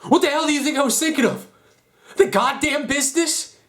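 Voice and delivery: high, loud, escalating rage; furious outburst. Acted. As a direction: build to an anguished, furious cry. Furious confrontation with the father.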